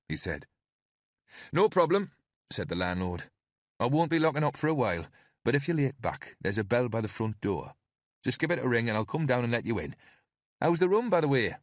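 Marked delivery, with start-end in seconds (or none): 1.5-2.1 landlord. Acted. Landlord answers Nightingale. 3.8-11.6 higher, quicker chatty publican voice; a pub landlord chatting with his only guest, higher and quick; friendly landlord. Acted.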